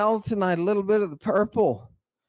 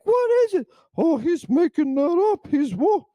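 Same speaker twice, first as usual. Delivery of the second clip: high, louder mock outcry; incredulous objectors; 10 semitones higher, 4 dB louder than usual. Preacher mimics people doubting his new passage, high mock disbelief. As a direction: squeal it as the baffled doubters. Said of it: "People are like".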